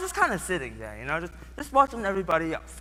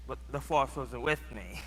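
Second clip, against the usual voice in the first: usual speaking voice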